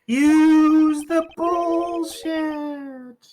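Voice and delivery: high, long-drawn eerie wail; ghostly far-off voice. Acted. A voice from nowhere prompting the thinker, drawn out and high. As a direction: stretch every word out, high and echoing. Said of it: "Whose voice was that?".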